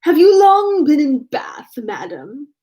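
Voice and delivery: affected high, drawn-out simper. A narrator's reading. Tilney teasing his dance partner with mock small talk, simpering. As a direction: put on a slow, sing-song simper, dropping on madam. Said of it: "affectedly softening his voice, he added with a simpering air".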